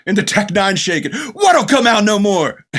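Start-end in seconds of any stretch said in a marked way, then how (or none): 1.3-2.7 higher, louder, slow baffled question; imitating Jack Burton. Retelling Jack questioning the old wizard, higher louder mock bafflement. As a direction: play the clueless tough guy demanding answers.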